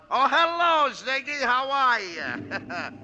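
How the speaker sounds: high, gushing hello, very slow how are you; effusive phone greeting. Acted. Rocco greets his boss Ziggy on the phone, gushing. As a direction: fawn over the caller. Gangster fawns over phone.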